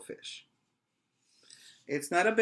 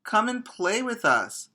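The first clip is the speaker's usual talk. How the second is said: high, loud playful call; excited calling voice; fish friends calling; 10 semitones higher, 4 dB louder than usual. Acted. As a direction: call out to a friend across the reef. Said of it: "they would call".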